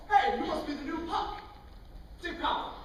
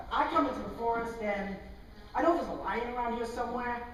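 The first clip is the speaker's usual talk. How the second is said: ordinary talk, nothing marked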